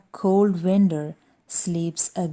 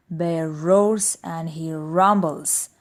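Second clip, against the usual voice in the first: plain reading voice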